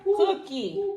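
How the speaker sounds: high, soft rising praise; high little reward call. Real, not acted. Mom rewarding child with cookie.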